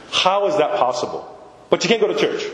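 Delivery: ordinary talk, nothing marked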